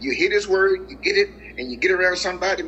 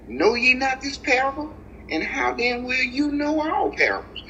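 scripture quote lifted much higher, like Jesus asking his disciples; voicing Jesus; 10 semitones higher than usual. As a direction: let the question ring out high as the Lord speaking. Preacher voices Jesus quoting scripture.

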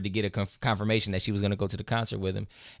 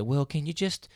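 higher, softer voice of a hesitant manager; lightly voiced tactful boss; retold delicate request; 4 semitones higher, 4 dB quieter than usual. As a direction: gently imitate someone making an awkward request.

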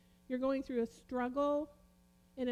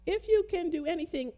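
raised, louder retort; retorting exclamation; 4 semitones higher, 5 dB louder than usual. Retold or imitated. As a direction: quote it back as an exclamation. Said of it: "exclamation point with quotes around it".

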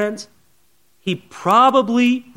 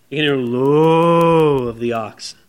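ordinary delivery

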